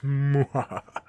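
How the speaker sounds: short, loud villain cackle; mock-sinister laugh burst; practised evil laugh. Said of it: "I'm still working on the laugh".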